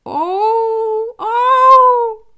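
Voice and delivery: high, loud, drawn-out wolf wail; pitiful howl held long and high; acted wolf groan, wailing; wolf's moaning howl. A narrator's reading. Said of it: "застонал волк".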